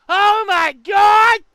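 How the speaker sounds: loud, slow mock-shocked yell; shouting. Real, not acted.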